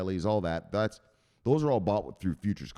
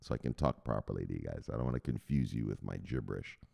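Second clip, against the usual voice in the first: calming down; 8 semitones lower, 6 dB quieter than usual. Real, not acted. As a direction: lower and softer, settling himself down. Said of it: "Let me, let me calm down".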